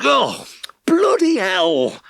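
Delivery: high, loud, slow pained wail; wailing moan. A narrator's reading. Farmer hopping on one leg, wailing in pain. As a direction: let the pain break out in a cry. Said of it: "he moaned".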